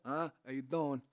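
a hint of a De Niro swagger; De Niro impression. Shown as a demonstration. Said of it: "basic De Niro".

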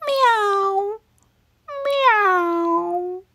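high, feeble cat mew; pitiful meow. Acted. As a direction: mew sadly like a sick cat. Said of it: "agreed Hamish pitifully".

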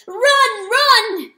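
high, loud urging yell; crowing shout. Acted. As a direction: crow the words like a rooster. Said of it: "the rooster crowed".

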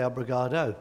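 Obrigado ending on a rounded -do, low; male form. Shown as a demonstration. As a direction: Obrigado with stress on ga and final o. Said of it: "we men, we say".

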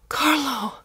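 high, loud cry from above; shriek. A narrator's reading. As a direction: shriek the name down from the roof. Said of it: "his wife shrieked from above".

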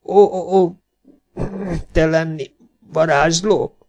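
magasabb, köhögő, töredezett beszédű sebesült férfi; nyögve, köhécselve, rossz magyarsággal kérdez; sebesült idegen, köhögve. A narrator's reading. Sebesült Fernandez törött magyarsággal. (sebesült Fernandez félve kérdezi Holdvilágot, köhögve, tört magyarsággal)

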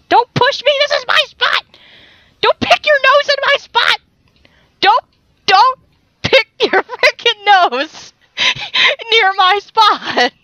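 high-pitched mock outrage, breaking into laughs; playful, squeaky telling-off; mock scolding. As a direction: scold him while trying not to laugh. Mock fight with game guard, cracking up.

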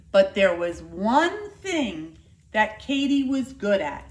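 lively, expressive picture-book reading; reading to children. Acted. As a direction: tell it like a storyteller for a class. Teacher reads picture book aloud.

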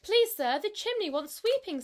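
high, loud timid plea; pleading kitten. Acted. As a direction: beg politely in a small high voice. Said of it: "said poor Tom Kitten".

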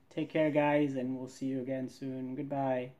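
ordinary delivery

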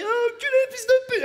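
high, loud hammed-up cursing; mock swearing rage. Godfather insulting the furniture for the crying kid, high hammed-up rage. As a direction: explode at the furniture in fake fury.

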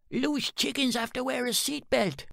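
high, jokey local lad; teasing second man. Acted. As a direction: rib the Englishman.

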